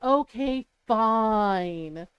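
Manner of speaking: drawn-out, low resigned agreement; grudging. Retold or imitated. Daughter who knows she's in trouble, grudgingly agreeing. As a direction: mimic a kid dragging out surrender.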